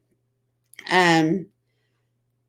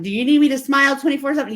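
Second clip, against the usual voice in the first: fast exasperated partner; 8 semitones higher, 6 dB louder, much faster than usual. Retold or imitated. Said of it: "and be like".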